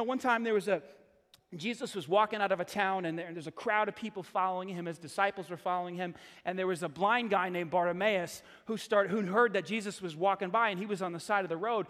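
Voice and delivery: ordinary talk, nothing marked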